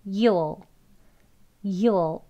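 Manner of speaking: YOU'LL without the long oo, "yuh-ol"; reduced, briefer "you'll"; shorter contraction. Shown as a demonstration. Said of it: "짧게".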